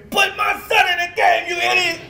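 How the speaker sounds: high, loud mock scream at the coach; screaming mom. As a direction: yell it like a furious mom in the stands. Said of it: "My mom's screaming".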